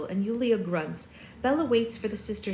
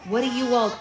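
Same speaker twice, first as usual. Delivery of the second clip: usual speaking voice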